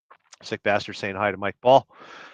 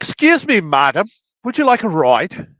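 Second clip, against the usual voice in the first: mock gentleman; 9 semitones higher, 7 dB louder than usual. He offers her a ride.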